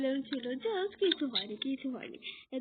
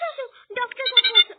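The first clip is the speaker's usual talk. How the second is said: calling out; 9 semitones higher, 12 dB louder, faster than usual. Acted. Calling the hurt child over.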